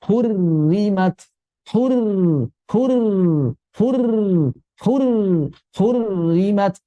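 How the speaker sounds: 'Hurrimat' slow, light held r joined to 'ri'; thin ro. Shown as a demonstration. Teacher models idgham, thin ro. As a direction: keep both r's in 'Hurrimat' thin. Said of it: "ro pertama pun sudah dibaca tipis".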